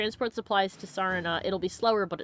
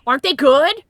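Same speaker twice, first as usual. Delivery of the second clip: excited gasp; 8 semitones higher, 12 dB louder than usual. Real, not acted. Gasp over brookies.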